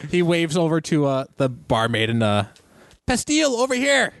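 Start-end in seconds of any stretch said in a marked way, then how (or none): 3.1-4.1 cheerful Canton. Acted. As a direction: call out merrily across the bar. GM voices Canton calling barmaid.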